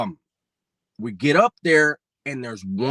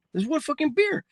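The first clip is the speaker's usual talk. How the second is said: high indignant outburst; incredulous; 13 semitones higher than usual. Retold or imitated. Telling his friend there's only one beer, high disbelief. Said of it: "I go".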